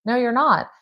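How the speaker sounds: no, you're not, said emphatically; firm rebuttal. Real, not acted. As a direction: shut down the panic. Firmly rebutting the panic.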